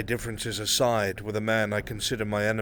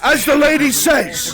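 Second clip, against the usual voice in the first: building from sharp questions to a full, much higher shout; furious accusation; 12 dB louder than usual. Acted. Noble berating the nobles across the table, in a rising furious shout. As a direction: rage at the idle nobles, louder with every line. Same tirade continues.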